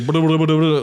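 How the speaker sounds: rapid burbling cartoon running sound; Scooby-Doo scramble noise. Doing feet running out the door, cartoon scramble. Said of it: "like, the Scooby-Doo".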